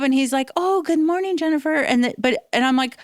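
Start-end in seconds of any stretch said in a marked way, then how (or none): 0.5-1.8 lightly voiced guy with a hint of an accent, pitched up; a light take on the friendly coworker's greeting; imitating the office guy. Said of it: "he had a little bit of an accent".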